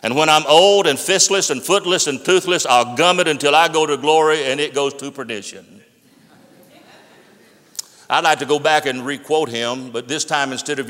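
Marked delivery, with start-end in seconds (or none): 0.0-5.8 louder, driving evangelist's declaration; fervent preaching. Acted. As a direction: pound out each vow like a revival preacher. Quotes evangelist's fiery statement.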